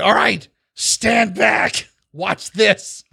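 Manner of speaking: high, slow grand warning; showboating goblin inventor. Acted. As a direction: announce the big demonstration. Goblin shows off his invention.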